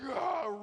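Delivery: loud high frustrated groan; raging like the Hulk. Retold or imitated. Pastor's inner Hulk rage groan, very loud. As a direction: growl it out like the Hulk. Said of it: "raging like the Hulk".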